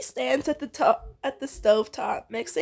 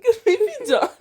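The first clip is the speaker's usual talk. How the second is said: laughing; 11 semitones higher, 5 dB louder than usual. Real, not acted. Friend teasing during the reading.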